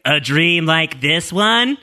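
slow, drawn-out mock ghost line; ghostly Ana Lucia. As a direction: stretch it out into a lingering question. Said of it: "ghost Ana Lucia, is like".

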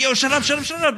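high, hammed-up frantic shushing; mock hushing. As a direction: squeak at him to shut up. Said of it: "And Elliot's like".

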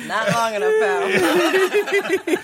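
high teasing jab through laughter; mock the excuse while laughing. Real, not acted.

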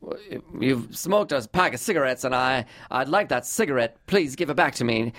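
put-on swooping, stagey old Hollywood line reading; mannered star of the old pictures, pitch swinging; stilted old-movie voice. Shown as a demonstration. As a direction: play a stilted old-time movie actor. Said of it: "stilted, like".

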